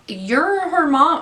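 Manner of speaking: high, slow, appalled; outraged disbelief. Real, not acted. Indignant viewer reacting to neglectful mother, high appalled disbelief. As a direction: let the disbelief soar on mom.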